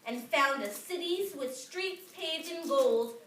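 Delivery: plain reading voice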